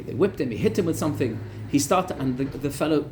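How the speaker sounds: normal talking voice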